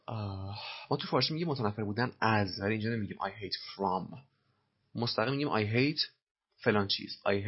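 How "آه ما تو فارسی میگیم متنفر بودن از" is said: ordinary delivery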